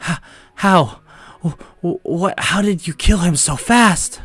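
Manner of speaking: high, drawn-out frightened stutter; panicked. A narrator's reading. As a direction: stammer it out in acted panic. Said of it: "begins to panic".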